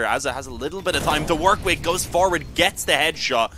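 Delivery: excited play-by-play. Real, not acted. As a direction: build the call to the headshot. Caster builds to a headshot.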